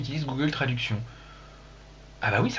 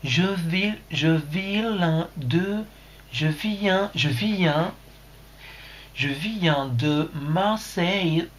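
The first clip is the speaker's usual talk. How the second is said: put-on very slow, halting French in an English accent; 2 semitones higher, much slower than usual. Streamer reading out Jean-Daniel's message, exaggerated English accent. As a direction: stretch the French like an English beginner. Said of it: "maintenant que je parle sa langue".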